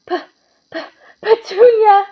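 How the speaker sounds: stammered, then a high, loud gasped cry; gasping in shock. A narrator's reading. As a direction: choke out the name in panic. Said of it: "he gasped".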